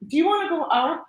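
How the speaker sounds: talking to the puppy. Real, not acted. Calling her puppy to go outside.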